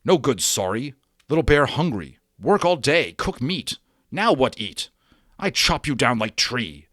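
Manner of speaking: loud, high broken-English threat; an angry little warrior demanding his dinner; angry Little Bear. Acted.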